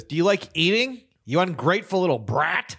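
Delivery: high, loud mock scolding; shrill-free hammed-up stepmother scold; wicked stepmother. Parent scolding child, joking retelling.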